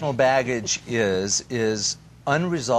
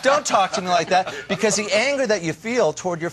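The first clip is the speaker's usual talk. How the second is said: defensive snap; 8 semitones higher, 4 dB louder than usual. Pretend. Acted defensive reply to partner.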